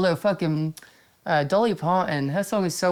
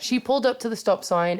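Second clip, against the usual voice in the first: usual speaking voice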